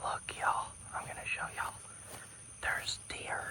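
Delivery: hushed whisper so the deer won't hear. Real, not acted. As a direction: breathe the words, no voice. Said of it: "I'm gonna start whispering".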